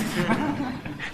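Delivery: a soft breathy chuckle; short laugh. Real, not acted. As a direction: laugh quietly at the memory. Amused at his own story. (tertawa kecil)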